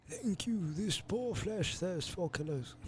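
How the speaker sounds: put-on high, quavering old man. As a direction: croak it like a frail, dying elder. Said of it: "give him an old man voice".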